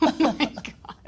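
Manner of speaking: real laughter through the words; laughing. Real, not acted. Host reacts to co-host's nerd voice, laughing. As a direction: crack up while reacting.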